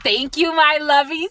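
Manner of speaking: high, excited thank-you. Real, not acted. Thanking viewers, then squealing it.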